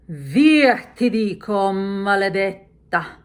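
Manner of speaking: loud, ringing order with a slow curse; frustrated command. Shown as a demonstration. Lady Macbeth furiously ordering the spot away. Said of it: "So once again".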